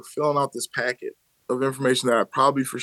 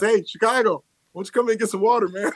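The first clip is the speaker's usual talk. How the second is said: coach calling out; 8 semitones higher than usual. Retold or imitated. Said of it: "He says".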